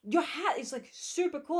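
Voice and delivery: imitating pushy guy praising her hat; mock eager guy. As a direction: gush like an overeager flirt. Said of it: "He's like".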